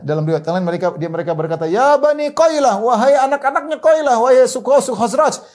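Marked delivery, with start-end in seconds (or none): 1.7-5.4 high-pitched rallying call; imitated shout. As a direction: cry out the news to the whole tribe. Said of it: "dengar teriakan orang Yahudi tersebut". (menirukan seruan lantang memanggil kaumnya)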